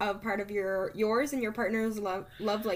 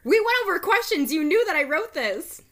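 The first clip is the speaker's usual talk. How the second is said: louder and higher, playfully indignant; 9 semitones higher, 9 dB louder than usual. Real, not acted. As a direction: protest it, loud and high. Mock outrage at friend.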